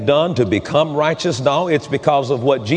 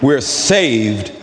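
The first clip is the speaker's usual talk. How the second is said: slow, weighty declaration with long pauses; preached testimony; much slower than usual. Real, not acted. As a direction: draw out each phrase as solemn testimony, rising on the pleading.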